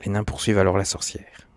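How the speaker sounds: usual speaking voice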